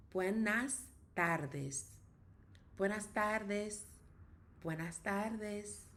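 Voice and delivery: Buenas tardes said very slowly, syllable by syllable; slow drill. Shown as a demonstration. Teacher modelling the greeting slowly for learners. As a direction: drag out buenas tardes for students to copy. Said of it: "Good afternoon".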